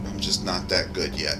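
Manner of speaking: ordinary talk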